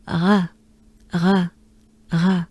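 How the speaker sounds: "Ra" breathed out from the throat, tongue kept down; French R. Shown as a demonstration. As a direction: exhale the "ra" with the tongue flat in the mouth. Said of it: "So it's something like this".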